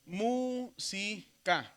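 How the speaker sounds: "música" drawn out very slowly, each syllable apart; stretched-out syllabic "música". Shown as a demonstration. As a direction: break "música" into slow separate syllables: mú, si, ca. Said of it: "Si dividimos silábicamente o en sílabas".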